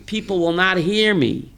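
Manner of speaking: usual speaking voice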